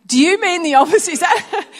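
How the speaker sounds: husband suspiciously asking if she means the opposite; mock suspicious question. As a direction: ask as if catching her out. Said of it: "the other person was saying".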